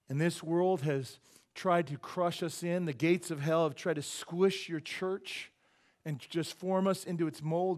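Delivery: loud, high, slow impassioned plea; fervent preacher's prayer. Real, not acted. Pastor praying over the church for zeal, fervent prayer. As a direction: cry out to God at full force, drawn out.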